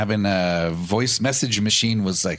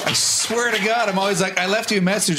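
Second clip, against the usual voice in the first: exasperated; 11 semitones higher than usual. Real, not acted. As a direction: draw it out high in mock despair. Mock exasperation about kids.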